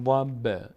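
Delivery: ضب with a small bouncing release on the b; qalqala on ضب. Shown as a demonstration. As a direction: let the final b of ضب echo briefly. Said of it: "یعنی میلرزه صدا".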